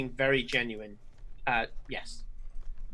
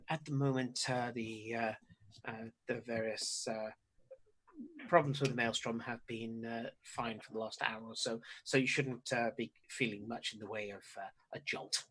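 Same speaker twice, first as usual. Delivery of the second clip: softly spoken wizard; 3 semitones lower, 10 dB quieter than usual. Acted. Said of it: "he says".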